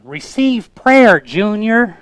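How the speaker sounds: high, loud, slow Southern-mom call; mock Southern mom. Mom telling her son to receive prayer, mock Southern call. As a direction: order your son in a hammed-up Southern accent. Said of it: "Was she from the South?".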